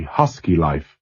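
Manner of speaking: plain reading voice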